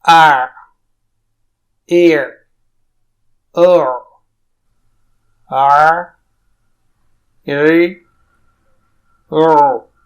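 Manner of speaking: aa, e, o pushed hard from the mid throat; strained throaty drill sounds; drill of ain vowels. Shown as a demonstration. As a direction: press the throat on each vowel. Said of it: "عین کی مشق اور practice".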